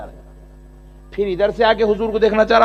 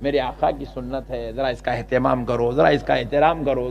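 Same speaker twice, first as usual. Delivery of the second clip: soft, low, kindly urging; gentle advice; 5 semitones lower, 3 dB quieter than usual. Shown as a demonstration. Preacher shows how a cleric should advise, soft gentle urging. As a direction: coax gently like a caring elder. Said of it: "تیرا کام ہے".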